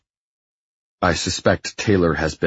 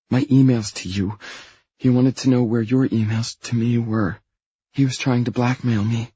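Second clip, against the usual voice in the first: higher-pitched voice for Anna; anxious Anna; 7 semitones higher than usual. Acted. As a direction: pitch it up for a worried woman.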